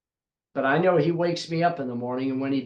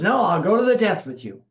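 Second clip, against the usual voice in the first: Peter's protest; 4 semitones higher, 6 dB louder than usual. Acted. Peter insists on dying with Jesus.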